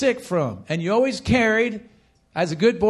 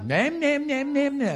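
mocking high, softer nam-nam chatter; griping teachers; 5 semitones higher, 4 dB quieter than usual. Preacher imitating teachers in the lounge, mock griping. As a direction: imitate complainers yammering on in a raised voice. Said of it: "all you're hearing the teachers do is".

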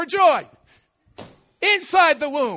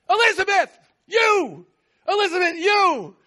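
excited cry; 5 semitones higher, 3 dB louder than usual. Acted. Acting Elizabeth's exclaim.

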